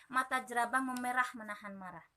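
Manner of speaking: plain reading voice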